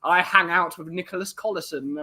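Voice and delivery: hammed-up posh Tunbridge Wells accent; mock posh accent. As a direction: do a silly upper-class English toff accent. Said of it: "dating someone from near Tunbridge Wells".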